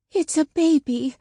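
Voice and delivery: high, hushed and tearful; a touch of tears in the wonder; tearful whisper. A narrator's reading. Woman finding a baby on her step, tearful. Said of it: "Nima whispered, her voice full of tears".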